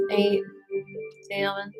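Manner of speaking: normal talking voice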